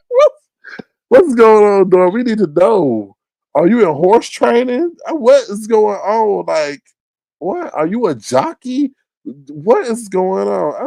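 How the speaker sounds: high, baffled comic interrogation; bewildered. Acted. Demanding Dory explain the disappearances, bewildered. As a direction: pile up questions, stretching some out in disbelief. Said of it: "we are just bewildered".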